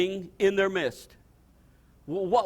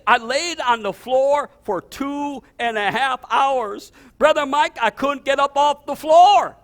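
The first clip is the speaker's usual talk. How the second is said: amazed retelling; 6 semitones higher, 7 dB louder, slower than usual. Retold or imitated.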